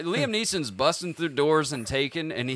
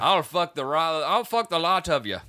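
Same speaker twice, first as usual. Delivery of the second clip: higher-pitched hammed-up Irish brogue; Irish accent; 4 semitones higher, 4 dB louder than usual. Starlet threatening all of Hollywood, feisty Irish accent. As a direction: rant in a mock Irish accent. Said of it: "Why is she Irish now?".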